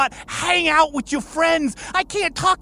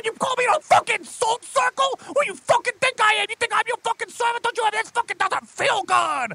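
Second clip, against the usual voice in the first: high, fast furious tirade; demon rant; 6 semitones higher than usual. Acted. Demon boss raging at his employee over the salt circle.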